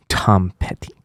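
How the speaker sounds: a quick joking whisper; breathy throwaway whisper; whispered aside. Real, not acted. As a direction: slip the pun in under your breath.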